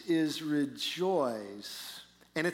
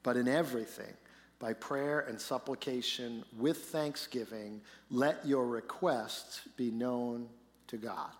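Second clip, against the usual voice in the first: scripture reading; 5 semitones lower than usual. Acted. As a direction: read the verses with reverence. Reads the scripture passage aloud.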